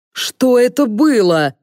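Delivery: high, loud outraged question; indignant. A narrator's reading. Director questions the actress after a bad take, indignant. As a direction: demand an explanation, appalled. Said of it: "возмутился постановщик".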